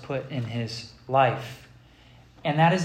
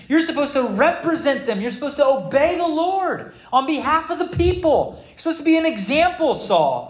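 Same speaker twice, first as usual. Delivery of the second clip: rising, louder preacher's rebuke; preaching rebuke; 8 semitones higher, 4 dB louder than usual. Acted. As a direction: build it up, scolding the king.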